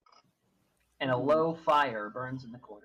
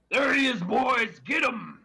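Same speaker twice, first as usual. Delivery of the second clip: a lightly voiced gruff thug shouting; 6 semitones higher, 4 dB louder than usual. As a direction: shout it like a brawler calling his gang. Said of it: "a deep, gruff voice shout".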